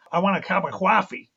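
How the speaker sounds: "coffee" as a gruff Brooklyn "cawfee"; a thick New York Brooklyn accent. Retold or imitated. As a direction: do Kojak's Brooklyn accent on "I want a cup of coffee". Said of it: "So it's like this".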